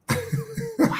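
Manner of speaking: squeaky burst of laughter; a short high laugh. Real, not acted. Laughs at his own story.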